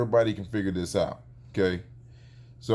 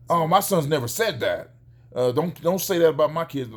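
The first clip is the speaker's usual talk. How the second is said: mock protest, louder and higher; indignant denial; 5 semitones higher, 4 dB louder than usual. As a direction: imitate an offended father protesting. Acting out indignant father's denial.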